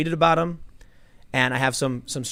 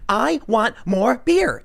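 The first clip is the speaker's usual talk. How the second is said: slow, higher, drawn-out demanding whine; whiny Becky; 8 semitones higher, 4 dB louder, much slower than usual. Retold or imitated. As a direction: stretch it out like a spoiled girl. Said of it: "she's going".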